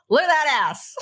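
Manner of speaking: playful laughing catcall; laughing tease. Retold or imitated. Wife teasing husband at the door, laughing. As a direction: tease him through a laugh. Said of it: "I'll back up and go".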